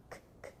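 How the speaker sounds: voiceless K, k; k sound. Shown as a demonstration. Teacher showing the class the K sound, soft voiceless clicks. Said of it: "Não é um som de K".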